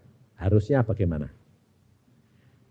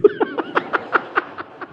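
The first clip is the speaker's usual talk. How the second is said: high, breathy burst of laughter; a real laugh out of sheer delight; breathless laugh; 4 dB quieter than usual. Real, not acted. To the congregation, laughing at his own story.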